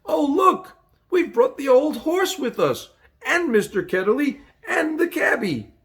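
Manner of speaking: put-on girl calling to her friend about what came along; cried out. A narrator's reading. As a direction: burst out with delight. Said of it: "Polly cried out".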